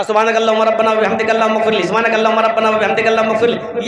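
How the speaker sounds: gabbled fast, words run together; rushed recitation. Shown as a demonstration. Preacher mocks worshippers who rush the prayer, very fast. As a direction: race through it carelessly. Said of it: "ऐसे मत पढ़ना".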